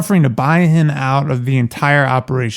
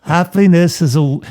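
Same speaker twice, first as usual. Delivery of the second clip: put-on British accent; 5 semitones higher than usual. Cohost mocks British voice with a cannoli joke. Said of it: "It sounds like a British".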